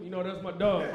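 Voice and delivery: ordinary delivery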